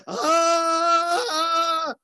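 long, high loud "aah" scream; imitated scream. A woman rolling on the floor, imitated high scream. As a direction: scream like the woman who fell. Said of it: "empieza a gritar".

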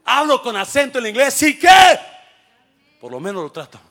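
high, loud, defiant shout. Acted. Pastor proclaiming defiance to critics, shouting. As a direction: shout it back in their face.

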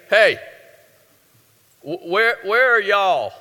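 mock Southern God, words stretched; Southern drawl. Preacher joking God is Southern, lightly mock Southern drawl. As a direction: playfully put a Southern twang on it. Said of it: "'Cause God's Southern".

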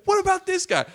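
high, loud mock protest; indignant protest. Comic pointing out the front-row talker, indignant. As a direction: squeal it in retold outrage.

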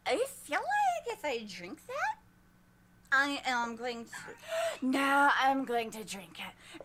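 lightly voiced tempted naiad; a hint of a giddy naiad; tipsy naiad. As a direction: talk yourself into it. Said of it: "sniffs at it and says".

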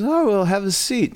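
high, welcoming retold voice; office hostess. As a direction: imitate a woman offering hospitality. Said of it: "and she goes".

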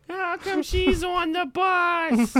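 squeaky put-on voice, dragged slow; a kid asking about the bus; high slow mock voice.

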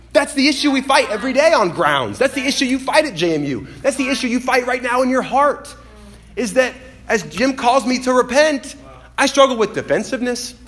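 high, driving pulpit delivery; forceful preaching. Real, not acted. Preacher confronting congregation over the daily fight, forceful preaching.

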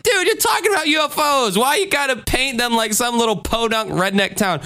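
high-pitched, incredulous complaint; pleading, high-voiced ribbing; mock exasperation. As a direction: protest in comic disbelief. Mock protest to the show.

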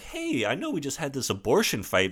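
high, coaxing mock-casual voice; imitating an overly breezy boyfriend; too casual. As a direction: wheedle casually, pitch climbing high at the end. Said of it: "he's a little too, like".

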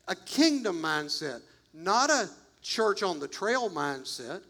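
ordinary talk, nothing marked

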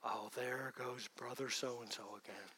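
low, soft dismissive mutter; quiet contemptuous aside; scornful inner muttering. Retold or imitated. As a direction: sneer it under your breath at a brother. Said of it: "That's what despising means".

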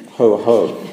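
loud gloating laugh-like ho ho; heffalump ho ho. A narrator's reading. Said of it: "said by a heffalump in that sort of voice".

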